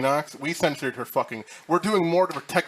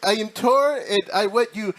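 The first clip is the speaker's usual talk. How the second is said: imitating Denox; 9 semitones higher, 4 dB louder than usual. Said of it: "He's like".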